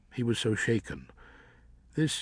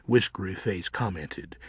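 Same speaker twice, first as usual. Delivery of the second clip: ordinary delivery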